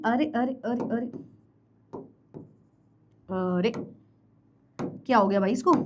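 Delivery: dropping low and soft in dismay; muttering as the screen misbehaves; flustered surprise. Real, not acted. Teacher reacting softly when the writing tool glitches.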